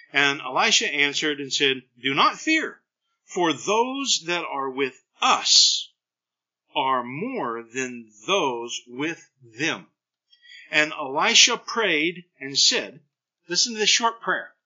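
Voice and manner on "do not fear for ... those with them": slow, steady, then low and hushed; calm reassurance. Acted. Elisha reassuring his panicked servant, slow and calm. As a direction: soothe a frightened servant slowly.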